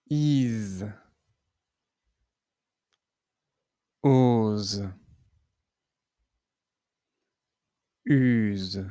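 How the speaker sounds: teacher modelling vowel-z syllables; reverse syllable drill. Shown as a demonstration. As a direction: sound out each syllable. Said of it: "Ce qui nous donne".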